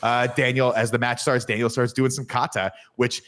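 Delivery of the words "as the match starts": ordinary delivery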